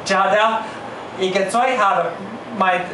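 normal talking voice

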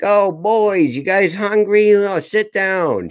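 high-pitched Italian grandmother voice; welcoming grandma. Retold or imitated. Italian gram greeting hungry boys in her kitchen, high welcoming voice. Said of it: "she'd be like".

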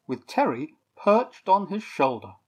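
ordinary delivery, nothing marked

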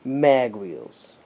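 ordinary delivery

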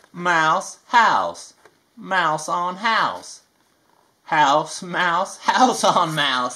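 slow, sing-song picture book reading; reading to children. A narrator's reading. Reading a rhyming book to kids, sing-song. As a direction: read each rhyme to a little one. Reads Dr. Seuss book aloud.

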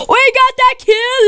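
high, bright cheer of victory; excited. Real, not acted. Gamer cheering his kill to viewers, high excited call. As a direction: crow about the kill.

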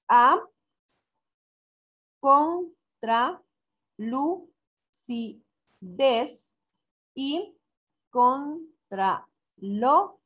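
each syllable said alone, pauses between; counting syllables. Shown as a demonstration. Teacher counting an example verse for students, syllable by syllable. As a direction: chop the verse syllable by syllable, slowly. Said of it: "Conté y en total tengo doce sílabas".

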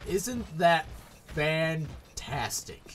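slow, heavily stretched sarcasm; bitter sarcasm. Real, not acted. Player who must run through it all, bitter sarcasm. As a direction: draw out the fake delight. Sarcasm after unhappy death.

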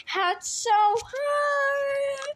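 very slow and high, stretched out; drawn out. Pretend. Child playing, drawn-out line.

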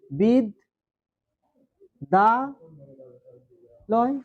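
বিদ, দা, লয় with long pauses between; syllable by syllable. Shown as a demonstration. Teacher shows the class syllable division, বিদ, দা, লয় slowly separated. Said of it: "ধীরে ধীরে উচ্চারণটা আমরা করছি".

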